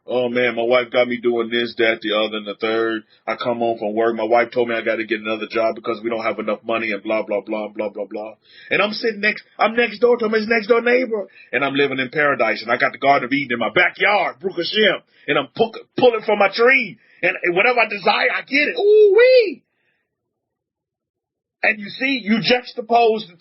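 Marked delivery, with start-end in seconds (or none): 0.1-8.3 lower, grumbling neighbor voice; complaining neighbor. Retold or imitated. Neighbor griping to me about his wife, in a low complaining voice. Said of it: "He complaining about her". 18.8-19.6 high, loud joyful whoop; delighted whoop. Real, not acted. Preacher whooping over his own paradise, high and loud.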